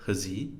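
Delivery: "huzzy" as has he run together, no second h; soft, schwa in the first syllable of "huzzy"; reduced linked form. Shown as a demonstration. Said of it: "reduced to e".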